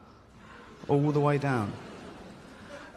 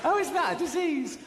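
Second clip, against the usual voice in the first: mock self-pity. Mocking obese woman's claim.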